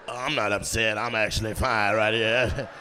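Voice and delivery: low, drawn-out mimicked voice; hammed-up low-voiced lady insisting; imitated audience woman. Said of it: "discuss why your voice sound like that".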